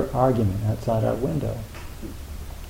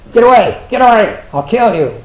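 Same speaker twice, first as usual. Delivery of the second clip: much higher, much louder squawking yell; angry bird shout; 9 semitones higher, 12 dB louder than usual. Pretend. Teacher voicing birds quarrelling outside, angry squawking shout. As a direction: shout like a territorial bird guarding its ground.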